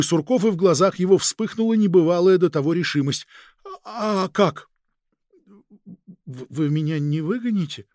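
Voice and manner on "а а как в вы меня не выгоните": hesitant, stumbling on first sounds; timid stammer. Acted. Agent asks the chief if he'll be dismissed, timid and halting. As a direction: ask it haltingly, unsure of the answer.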